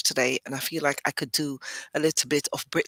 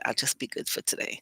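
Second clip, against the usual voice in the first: fast whispered aside; quick hushed self-correction; 3 semitones lower, 6 dB quieter, faster than usual. Real, not acted. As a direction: rush it under your breath.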